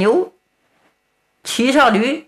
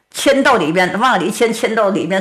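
usual speaking voice

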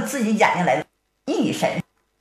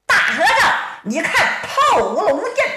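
high, raised-voice battle cry; loud challenge shout; 9 semitones higher than usual. A narrator's reading. Ruan Ying shouts from outside at the big monk, high challenge shout. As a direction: call it out high and hard to a foe. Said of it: "在外边高声喊道".

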